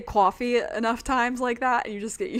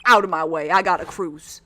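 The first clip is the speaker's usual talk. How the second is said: put-on New York biker threatening a stranger, thick accent; 3 semitones lower, 4 dB louder than usual. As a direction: play a New York street tough squaring up over his bike. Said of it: "Nice New York accent right there".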